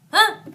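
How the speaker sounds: short sharp "Ah!" try; startled gasp. Shown as a demonstration. Reader trying out the startled sound. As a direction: test a startled yelp. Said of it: "more of a startled sound, like".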